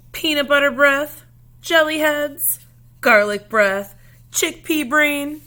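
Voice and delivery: loud, high playground jeers; taunting insults. Acted. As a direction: hurl them across the lunchroom. Kids trading lunchroom insults.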